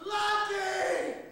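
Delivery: high, loud final cry; drowning senator's last shout. A narrator's reading. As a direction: cry out the dog's name one last time. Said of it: "his last shout".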